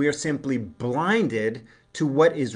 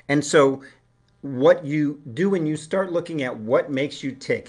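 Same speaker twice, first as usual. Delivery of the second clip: ordinary talk, nothing marked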